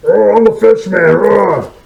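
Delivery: loud, high monster voice; Fishman monster. Pretend. Showing off his mask, loud monster voice. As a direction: growl it out like a fish creature.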